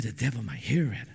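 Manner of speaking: low, hushed confiding voice; afraid of being overheard. Retold or imitated. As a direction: murmur it so the devil can't hear. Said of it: "This guy was afraid the devil will hear".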